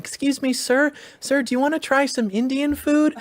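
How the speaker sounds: imitating sweet little immigrant girls; little girls' voice. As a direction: mimic a small girl offering food politely. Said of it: "And they were like".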